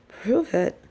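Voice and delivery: usual speaking voice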